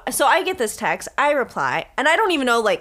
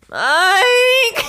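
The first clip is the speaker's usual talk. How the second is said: high, loud, drawn-out mock yell; retold yell; 11 semitones higher, 7 dB louder than usual. Locked-out friend yelling for sleeping roommate, retold yell. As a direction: holler for the roommate to open up. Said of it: "yelling for him, knocking".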